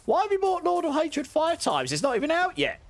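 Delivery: high, shocked disbelief; can't believe what they read; incredulous. Real, not acted. Reading a shocking chat message.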